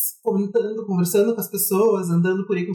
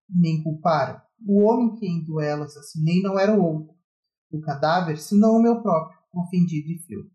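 poetry recitation; 4 semitones lower than usual. Acted. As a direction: read it like a grave poem. Recites a poem on podcast.

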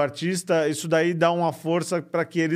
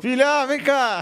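calling out; 9 semitones higher, 4 dB louder, slower than usual. Real, not acted. Calling her daughter over.

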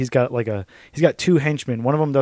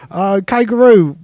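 much higher, louder Aussie voice; drifting Australian accent; 9 semitones higher, 9 dB louder than usual. Pretend. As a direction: slide into a hammed-up Australian. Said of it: "drifting through accents".

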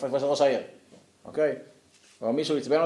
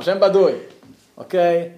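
ordinary delivery

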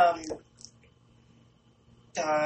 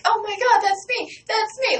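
much higher, louder shrieked delight; fangirling; 4 dB louder than usual. Retold or imitated. Hearing her name from the stage, retelling a high excited shriek. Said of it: "like, fangirling, jumping around".

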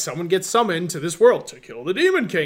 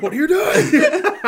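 high, loud, laughing disbelief; giggling mock confusion; laughing bewildered quote; 12 semitones higher, 8 dB louder, slower than usual. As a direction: squeak it out through laughter. Wolf man bewildered.

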